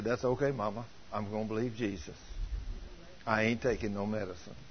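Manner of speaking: soft, low, unbothered reply; retold boy's hushed, settled refusal; imitated calm child. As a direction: answer the threat quietly and surely. Boy answers threat calmly.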